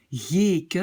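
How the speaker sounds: YÉKE with the first E held long. Shown as a demonstration. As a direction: draw out the vowel in yéke, yéee-ke. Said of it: "je prononce le E long".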